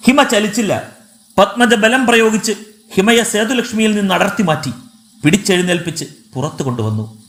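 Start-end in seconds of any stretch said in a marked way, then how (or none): none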